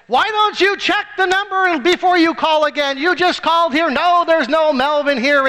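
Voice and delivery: high, loud irritated outburst; angry phone answerer. Retold or imitated. Man annoyed by a repeat wrong-number call, angry. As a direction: snap back furiously at a repeat caller. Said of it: "let me kind of show you what anger is".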